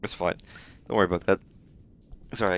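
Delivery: ordinary talk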